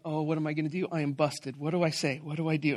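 low, quiet fretting to oneself; worried inner thought. Retold or imitated. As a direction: think aloud softly, mock dread. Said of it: "I'm thinking".

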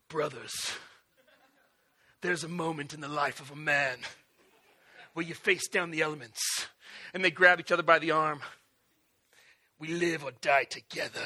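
low, hushed, solemn hero voice; epic movie speech. Acted. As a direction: deliver a grave movie-hero speech, deep and soft. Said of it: "like, this epic speech".

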